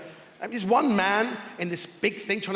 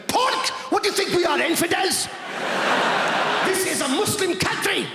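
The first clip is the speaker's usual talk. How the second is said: outraged guide; 8 semitones higher than usual. Retold or imitated. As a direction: explode in mock outrage at the request. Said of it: "He went".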